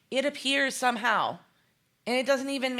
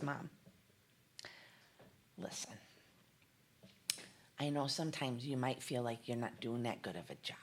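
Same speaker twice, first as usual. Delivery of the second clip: put-on soft, much lower whisper of the boy's words; whispered son's words; 10 semitones lower, 14 dB quieter than usual. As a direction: hush it like a boy comforting his mom. Son's words retold to mother.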